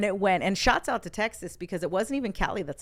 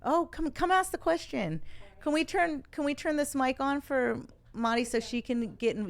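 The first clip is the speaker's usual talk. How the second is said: higher-pitched, inviting call; calling someone over; 7 semitones higher than usual. Real, not acted. Inviting someone off-mic to ask.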